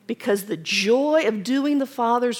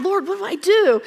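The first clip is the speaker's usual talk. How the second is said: crying out; 9 semitones higher, 4 dB louder than usual. Retold or imitated. Wife retelling crying out to God, pleading. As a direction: plead with God, half breaking. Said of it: "crying out".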